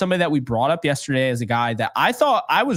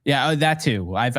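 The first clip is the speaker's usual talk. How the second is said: normal talking voice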